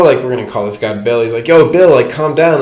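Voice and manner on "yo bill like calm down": calling out. Retold or imitated. Homie calming Bill.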